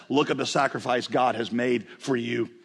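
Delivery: normal talking voice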